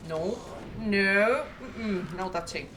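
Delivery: firm, loud no; loud refusal. Real, not acted. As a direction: refuse loudly and firmly. Killer approaching her character.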